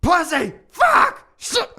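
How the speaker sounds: high, loud screamed curses; screaming swears. Retold or imitated. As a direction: scream it across the yard in grief. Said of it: "starts screaming in my yard".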